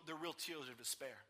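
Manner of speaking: usual speaking voice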